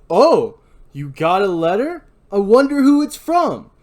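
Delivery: slow, loud, rising mock surprise; angry friend mocking the suspect's story; sarcastic sneer. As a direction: drip scorn over it. [name] mocks Ray.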